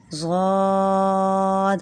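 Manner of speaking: ضاد said heavy and long, ending in a dal sound; heavy drawn Zwad. Shown as a demonstration. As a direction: stretch ضاد thick. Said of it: "ضاد کو موٹا کرنا ہے اور کھینچنا ہے".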